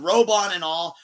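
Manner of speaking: ordinary talk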